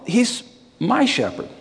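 high, emphatic "my", then a drop on "shepherd"; stress on MY. Shown as a demonstration. Preacher rejecting just a shepherd, stress on "my". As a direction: insist on "my" as a correction. Corrects "a" to "my.

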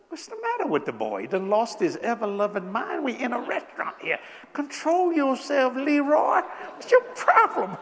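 rising in mock disbelief; mock exasperated. Scolding his friend Leroy for a mushy toast, mock exasperated. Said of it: "I'm looking at him and said".

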